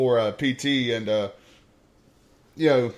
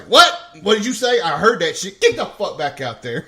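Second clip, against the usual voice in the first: much higher, louder startled shout, settling down; sergeant shout; 3 semitones higher, 7 dB louder than usual. Retold or imitated. Sergeant catching the recruit's insult, loud shout. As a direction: snap back at the muttering. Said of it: "And he's like".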